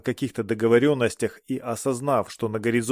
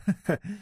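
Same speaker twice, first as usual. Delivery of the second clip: put-on short girlish giggle; a quick high titter; 8 semitones higher than usual. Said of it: "Астаупа хихикнула".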